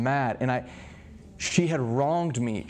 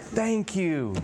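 high, mock-grateful thank you; vindicated thanks; 7 semitones higher than usual. Husband to God after hearing he is right, vindicated. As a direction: play up feeling proven right, pitched up. Acted relief at being backed up.

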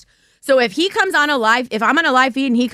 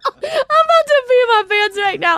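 high, squealing through real laughter; helpless laughter; 9 semitones higher than usual. Real, not acted. Telling listeners she may wet herself, helplessly laughing. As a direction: squeeze the words out while cracking up.

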